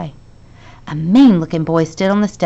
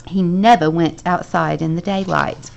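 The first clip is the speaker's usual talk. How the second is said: ordinary delivery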